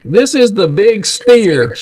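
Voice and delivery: high and slow, breaking into a laugh; granddad on the CB. Retold or imitated. Grandson imitating granddad's CB call name, laughing. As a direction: say it proud, laughing through it. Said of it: "he's like".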